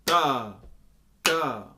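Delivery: Ta and ka hit loud and heavy as downbeats; syllables as downbeats. Shown as a demonstration. Teacher accenting Ta and ka as downbeats. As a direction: land hard on Ta and ka. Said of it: "will feel like a downbeat".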